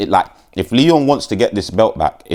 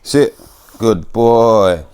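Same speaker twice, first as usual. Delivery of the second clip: talking to the dog; 3 semitones higher, 3 dB louder, much slower than usual. Real, not acted. To the dog on camera, calm commands. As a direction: settle the dog down, coaxing.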